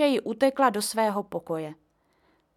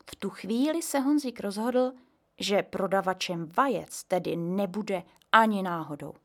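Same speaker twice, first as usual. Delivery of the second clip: normal talking voice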